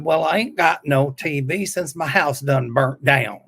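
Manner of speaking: slow, drawn-out Southern drawl; Southern accent. Acted. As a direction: answer the caller in a put-on Southern accent. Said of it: "So I put on the Southern accent".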